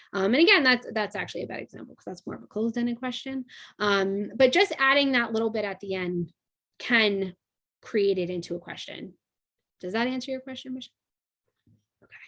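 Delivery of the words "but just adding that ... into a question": usual speaking voice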